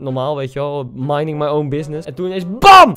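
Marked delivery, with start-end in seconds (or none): 2.6-3.0 loud mock bam. Retelling a ball to the face.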